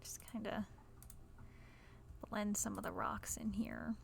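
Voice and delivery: soft whispered aside while placing rocks. Real, not acted. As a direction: drop to a whisper and murmur to yourself. Quiet commentary while building.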